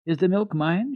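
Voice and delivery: put-on high, eager child's voice; a small girl checking her share; little girl asking. A narrator's reading. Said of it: "she asked".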